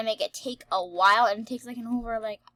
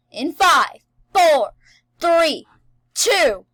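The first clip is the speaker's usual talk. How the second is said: counting down, louder each number; loud countdown; 5 semitones higher, 15 dB louder, slower than usual. Real, not acted. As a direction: shout the countdown. Counting down loudly.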